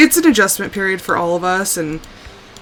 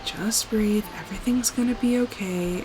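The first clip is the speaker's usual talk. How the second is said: self-soothing; 2 semitones higher, 8 dB quieter than usual. Retold or imitated. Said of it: "I keep telling myself".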